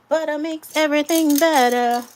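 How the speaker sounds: belted out loud and high; sing-song proud declaration; loud catchphrase. Real, not acted. Loud emphatic repeat of catchphrase.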